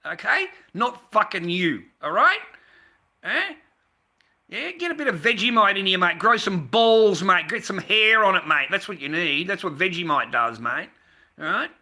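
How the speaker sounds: punchy boasting with rising challenge tags; blustering taunt. Real, not acted. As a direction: goad the viewer with loud bravado. Taunts American viewer about Vegemite.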